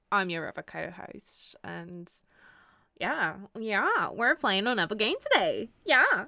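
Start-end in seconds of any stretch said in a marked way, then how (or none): 3.0-6.3 excited. Real, not acted. Excited cry about the game.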